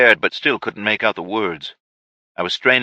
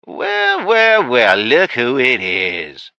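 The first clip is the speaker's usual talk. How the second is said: sing-song high well well, slow finish; mocking villain greeting; 4 semitones higher, much slower than usual. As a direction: savor the surprise, drawn out. Ray greets captives mockingly.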